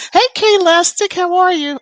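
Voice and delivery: light up at seeing a viewer; excited greeting. Real, not acted. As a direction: sing out the greeting.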